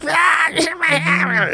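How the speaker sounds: high, shrieking cry of pain; mock scream. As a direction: shriek like a hurt crybaby, hammed-up. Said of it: "he starts screaming like a little bitch".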